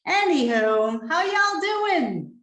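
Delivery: put-on a playful try at a Southern accent, stretching the vowels; attempt at Southern. Speaker greeting a South Texas audience in mock Southern accent. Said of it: "That's my attempt at being Southern".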